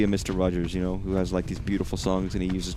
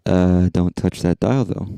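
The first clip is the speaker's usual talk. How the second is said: slow, much louder broadcast sign-off; mock announcer; 8 dB louder, slower than usual. Streamer stepping away, a mock TV announcer sign-off. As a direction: play a hammy TV host going to a break.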